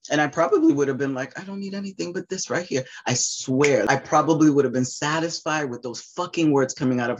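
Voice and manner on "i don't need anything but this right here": high, soft, quick and heartfelt; higher softer longing reply; tender reply. Retold or imitated. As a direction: say it like a grateful child. Her imagined reply.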